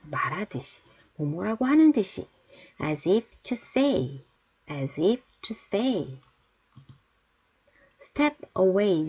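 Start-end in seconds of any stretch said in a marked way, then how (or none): 2.8-6.2 very slow, each word drawn out; slow teacher demo; slow model reading. Shown as a demonstration. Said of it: "teacher models phrase slowly". 8.0-9.1 very slow, each word drawn out; slow teacher demo; slow model reading. Shown as a demonstration. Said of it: "teacher models phrase slowly".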